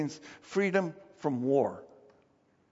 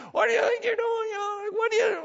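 angry neighbour screaming; faster than usual. Retold or imitated. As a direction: imitate an outraged neighbour yelling in his face. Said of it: "oh, they're just screaming at me".

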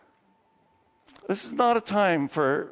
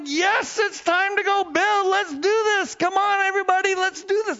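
much higher, loud eager rallying cry; excited; 4 dB louder than usual. Acted. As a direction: fire everyone up to go build. People excited to build temple.

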